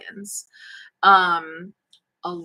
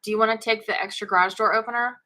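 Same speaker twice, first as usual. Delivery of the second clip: fretful worried mom; 3 semitones higher, 5 dB louder than usual. Real, not acted. Mom to son leaving by bike.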